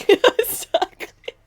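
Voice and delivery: high, loud word bursting through laughter; laughing hard. Real, not acted. Streamer agreeing with chat about the kingdom, laughing hard. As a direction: choke the word out, drawn out, through real laughter. Helpless laughter while chatting.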